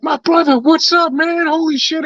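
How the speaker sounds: high-pitched overjoyed friend; excited greeting. Retold or imitated. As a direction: hail a new brother with delight. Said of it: "he's like".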